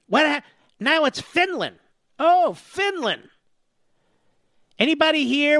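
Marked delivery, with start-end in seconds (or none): 2.2-3.3 sarcastic drawl. Real, not acted. Sarcastic drawn-out Finland.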